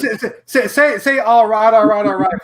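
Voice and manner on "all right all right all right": lightly mimicked drawling actor catchphrase, a bit louder; a hint of the actor's catchphrase, raised to coax the caller; Matthew McConaughey. Shown as a demonstration. Host feeding caller the catchphrase, light impression. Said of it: "you sound like Matthew McConaughey".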